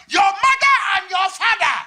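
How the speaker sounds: put-on high, shouted accented insult; African dad's diss. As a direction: hurl the insult at full volume. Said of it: "where African hits you with a diss".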